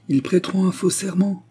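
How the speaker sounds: high, intense retort; concentrated energy. A narrator's reading. Anna answers the accusation against Axel, with concentrated energy. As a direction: fire back with concentrated force. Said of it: "répliqua Anna avec la même énergie concentrée".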